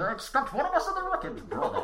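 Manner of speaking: muffled, high Sean Connery as Bane; Bane impression. Shown as a demonstration. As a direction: talk through a hand like Bane. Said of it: "put your hand over your mouth and do a high-pitched Sean Connery impression".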